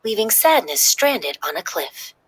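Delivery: usual speaking voice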